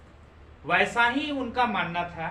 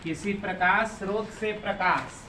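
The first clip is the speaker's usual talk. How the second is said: dictation. Acted. Teacher dictating notes slowly.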